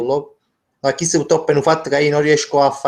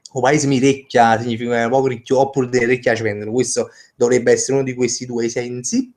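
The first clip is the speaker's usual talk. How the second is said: usual speaking voice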